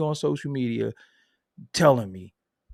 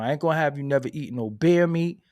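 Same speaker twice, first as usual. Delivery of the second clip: usual speaking voice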